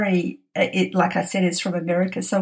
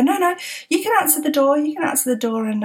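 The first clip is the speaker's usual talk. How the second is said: hurried excited; 7 semitones higher, faster than usual. Retold or imitated. Hurrying out with the dog.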